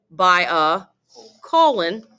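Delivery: ordinary delivery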